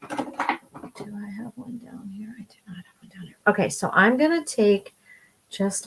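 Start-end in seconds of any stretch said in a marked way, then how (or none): 0.1-3.4 very soft, off-mic aside to oneself; talking to oneself. Real, not acted. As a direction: wonder quietly aloud, dropping right down. Talks to herself searching for brush.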